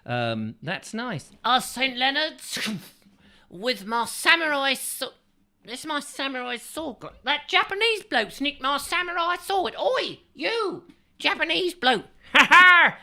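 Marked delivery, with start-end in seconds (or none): 1.4-12.1 much higher, hammed-up bad Japanese accent; a mock Japanese swordsman, shrill and boastful. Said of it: "not quite perfected Japanese accent". 12.3-13.0 loud hammed-up villain laugh; triumphant mock laugh.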